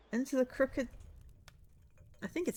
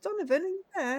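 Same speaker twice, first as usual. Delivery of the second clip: put-on high, fumbling voice of a lost young man; squeaky, befuddled young man hunting his glasses; high confused voice searching for his glasses; muddled young man; 11 semitones higher, 5 dB louder than usual. Voicing lost short-sighted young man.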